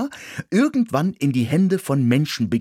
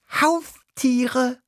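very high squeaking voice; squeaky guinea pig. Acted. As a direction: squeak the word out. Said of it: "fiebte das Meerschweinchen".

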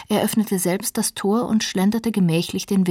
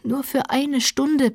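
raised, drawn-out plea; earnest pleading; 7 semitones higher, slower than usual. A narrator's reading. Heathcliff begs Catherine to let him go, pleading. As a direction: beg slowly and earnestly. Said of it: "bat er inständig".

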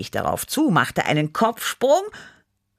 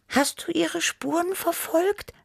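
high, eager little cat voice; Tibi. A narrator's reading. Tibi questions Chili about the trail, high and eager. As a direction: ask in a very high, small voice. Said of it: "fragte Tibi".

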